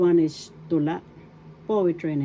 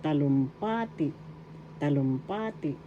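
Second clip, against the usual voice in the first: teacher's model of talumpati, accent on PA; stress on third syllable; 4 dB quieter than usual. Shown as a demonstration. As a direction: lean on the pa in talumpati. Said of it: "third syllable has the accent".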